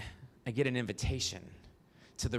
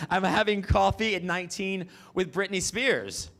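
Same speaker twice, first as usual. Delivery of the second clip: giddy disbelief; 6 semitones higher, 6 dB louder than usual. Retold or imitated. Still starstruck, retelling.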